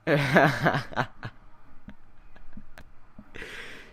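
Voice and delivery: breathy, voiceless chuckle; delighted laugh. Real, not acted. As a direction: laugh with glee on mostly breath. Delighted by surprise entrance.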